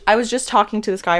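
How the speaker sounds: ordinary talk, nothing marked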